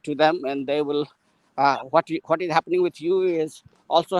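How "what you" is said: normal talking voice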